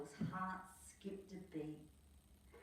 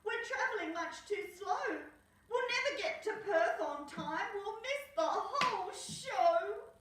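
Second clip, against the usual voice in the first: high, louder put-on voice, slowing at the end; despairing Skip; 14 semitones higher, 6 dB louder than usual. Skip fearing they will miss the race, high wailing voice. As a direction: wail about missing the show. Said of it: "said Skip".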